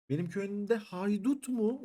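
high, slow, stretched outrage at "benim köyümde haydut mu"; who dares raid my village; shocked disbelief. Real, not acted. Finds bandits in his village.

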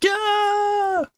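very high shrieked yeah; piercing childish scream; girl's scream. Acted. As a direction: shriek in panic. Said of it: "All three of them screaming".

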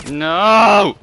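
sudden loud cry of frustration; loud yell. Real, not acted. Gamer yelling at the game as he gets hit. Shot while going for tank.

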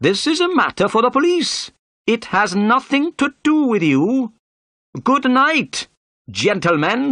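high, loud, indignant dismissal; angry. A narrator's reading. Inspector telling the detective to keep out, angry. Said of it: "turned to Holmes angrily".

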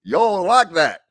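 high Southern twang; Southern accent. Retold or imitated. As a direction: show off the Southern voice. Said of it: "And I can talk Southern".

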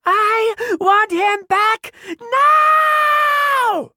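put-on very high, slow child's scream; ear-splitting shriek. A narrator's reading. Ruby in hysterics demanding Malcolm, ear-splitting shriek. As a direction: scream it out, stretching the last word. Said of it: "Her shriek was ear-splitting".

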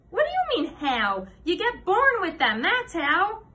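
high, loud, incredulous schoolboy; indignant comeback, punched high; scoffing. A narrator's reading. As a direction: scoff at a silly question, pitched right up. Nicky scoffs at silly question.

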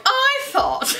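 very high, drawn-out squeal; silly mock delivery. Vlogger mocking how they just said it, silly high voice. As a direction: mimic your own odd high-pitched voice. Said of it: "I don't know why I'm like".

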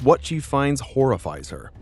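usual speaking voice

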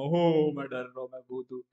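hammed-up scared thought; mock fright. Showing viewers how not to think in a nightmare, mock fright. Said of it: "तो ऐसे मत सोचना".

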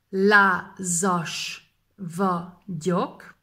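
Lázas vagyok stretched very slowly. Shown as a demonstration. Teacher repeats the phrase slowly for learners. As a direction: drag each syllable out. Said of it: "slow repeat".

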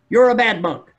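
loud, high accusation; acted angry outburst, pitched high; angry monk. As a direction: burst out like an outraged monk. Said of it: "his colleague is still angry".

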